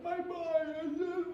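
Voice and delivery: high slow broken whimper; drunk woman crying. Retold or imitated. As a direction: trail off in a teary wail. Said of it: "a drunk woman crying".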